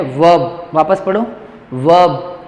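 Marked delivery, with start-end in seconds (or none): 0.0-0.7 वर्ब as "vawb", silent R; correct pronunciation. Shown as a demonstration. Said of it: "ये क्या है?". 1.7-2.4 वर्ब said "vawb"; correct pronunciation. Shown as a demonstration. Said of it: "ए का sound आना चाहिए".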